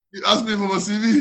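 high, loud, fast comeback; mock indignant protest. Answering the friend who mocked his CV, indignantly. Acted argument over the CV.

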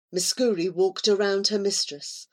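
ordinary delivery, nothing marked

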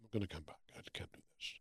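soft, fast, half-whispered complaint; hushed rant to his agent; muttered to himself. Retold or imitated. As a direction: grumble it quietly, under your breath. Imagined call to agent, muttered.

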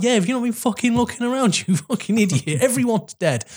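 mocking retort. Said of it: "It was like".

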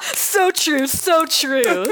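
high sarcastic agreement; mock agreement. Mock agreement with self-roast.

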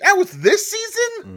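high, loud squeal of disbelief on season; shocked disbelief voice. Shown as a demonstration. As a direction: squeak it out, as if you can't believe it. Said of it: "do 'em really quick".

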